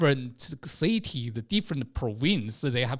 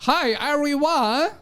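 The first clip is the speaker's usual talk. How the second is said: Chinese English teacher; 11 semitones higher than usual. Retold or imitated. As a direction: address the class like an eager teacher. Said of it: "that lady was like".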